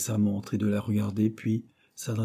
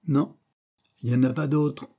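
the Quaker insists, each word leaned on, louder; emphatic, louder denial; 5 semitones higher, 5 dB louder than usual. A narrator's reading. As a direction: hammer it home firmly, louder. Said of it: "répéta le Quaker avec emphase".

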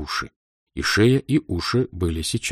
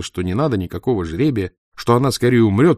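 plain reading voice

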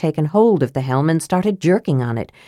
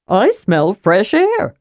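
cheerful kender; 3 semitones higher, slower than usual. A narrator's reading. Tass voice.

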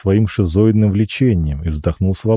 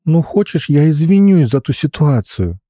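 put-on pleading girl; 7 semitones higher than usual. Alina pleads.

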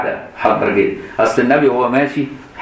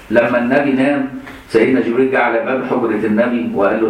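normal talking voice

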